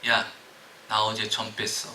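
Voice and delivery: punchline muttered with a smile; sly smiling quip. Pretend. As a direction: lean in low with a grin. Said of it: "빙그레 웃으면서 그에게 하는 말".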